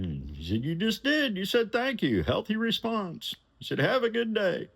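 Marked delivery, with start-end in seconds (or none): 0.6-3.4 high, breezy rich man; high client voice. Retold or imitated. John voiced praising him. 3.8-4.7 high, breezy rich man; easygoing boss reassuring the driver, high and loud; high client voice. Retold or imitated. John voiced saying goodbye.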